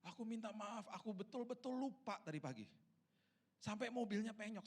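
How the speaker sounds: high-pitched, pleading tearful apology; acted half-crying plea. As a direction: imitate a remorseful child near tears. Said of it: "dia setengah nangis lagi, dia bilang gini".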